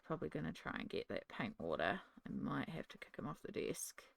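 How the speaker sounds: soft unvoiced whisper to the viewer; whispered aside. Real, not acted. Aside about cat near paint water.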